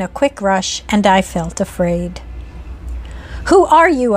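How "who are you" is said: nervous, hurried question; a touch of fright, asked quickly. A narrator's reading. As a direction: blurt it out nervously. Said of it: "I repeated quickly and nervously".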